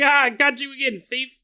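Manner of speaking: mock gloating cop. Cop mocking the thief he caught again, hammed-up gloating.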